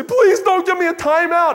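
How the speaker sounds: high, loud mock-child plea; imitated pleading child. Child begging parent in public, loud high pleading. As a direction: beg like a little kid in public.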